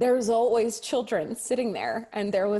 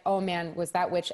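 normal talking voice

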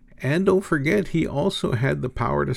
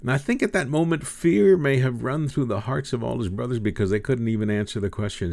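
ordinary delivery

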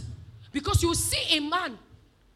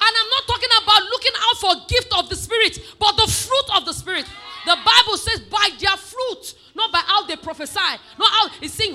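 loud, raised, emphatic sermon voice; full-force preaching; 6 semitones higher, 11 dB louder than usual. Real, not acted. Preacher teaching on fruit of the Spirit, forceful and loud. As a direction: drive each phrase home to the congregation.

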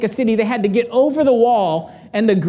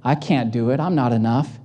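lower, softer and timid; scared soldiers; 8 semitones lower, 4 dB quieter than usual. Retold or imitated. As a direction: mumble it like frightened men.

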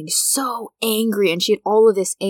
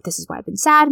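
upset friend; 10 semitones higher, 5 dB louder, faster than usual. Retold or imitated.

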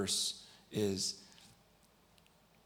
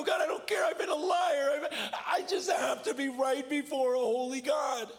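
loud, very high tearful cry; anguished confession; 5 dB louder than usual. Retold or imitated. Bawling boy confessing before God, anguished shout. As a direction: cry out desperately, imitating a broken confessor.

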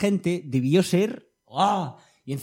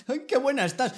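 leering flirt; 10 semitones higher than usual. Retold or imitated. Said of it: "tenga que decirle".

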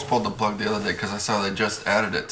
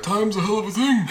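high, laughing mock quote; lightly hammed-up old wizard line, amused; Dumbledore; 10 semitones higher than usual. Quoting Dumbledore to friends, playful high imitation.

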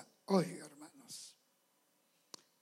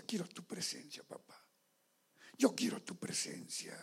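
soft prayer; 4 semitones lower, 9 dB quieter than usual. Retold or imitated. Pastor voicing his whispered prayer.

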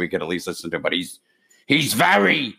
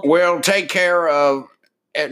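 high, very slow "will take care of it"; failed Biden impression; 8 semitones higher, 4 dB louder, slower than usual. Host attempting Biden, high and slow. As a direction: stretch it out, reaching for an old politician. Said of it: "it's not happening for me".

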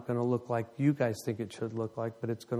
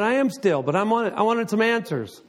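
insistent retort; 8 semitones higher, 6 dB louder than usual. Retold or imitated. Arguing back with God.

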